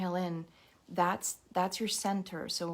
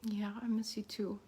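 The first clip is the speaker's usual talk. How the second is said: holding back tears; 3 semitones higher, 3 dB quieter than usual. Real, not acted. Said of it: "trying not to cry".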